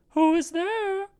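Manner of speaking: very high, thin falsetto granny; old grandmother. A narrator's reading. Old grandmother speaks from bed.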